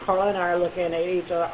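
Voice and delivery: ordinary delivery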